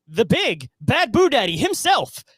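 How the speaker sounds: slow, loud boxing-ring announcer. Acted. Grand host introduction of co-host.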